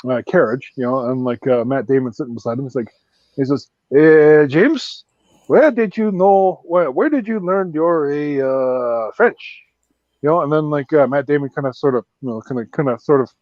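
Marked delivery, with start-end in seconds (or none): 4.0-9.6 put-on Frenchman's accent. Frenchman in the carriage questioning Matt Damon, slow French accent. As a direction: put on a halting Frenchman's English. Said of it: "this Frenchman, uh, like, uh, he's from France".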